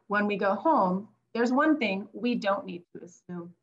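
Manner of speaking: gentle read-aloud to a class; picture-book reading to children; reading like a teacher. Acted. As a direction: read it like a teacher to young kids. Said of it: "I'm gonna read it like a teacher".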